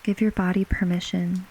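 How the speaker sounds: usual speaking voice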